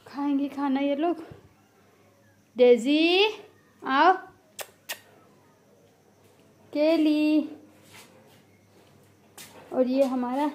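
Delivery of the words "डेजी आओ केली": loud call to the cats. Real, not acted. Owner calling her cats loudly to come. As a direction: call them over, carrying far.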